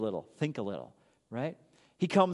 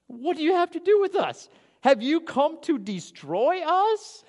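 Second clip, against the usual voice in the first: preacher acting the demons inside the man, scared and high; frightened demon voice; 12 semitones higher, 4 dB louder than usual. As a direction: stretch it out like a cornered demon. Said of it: "the demons inside of the man speaking out".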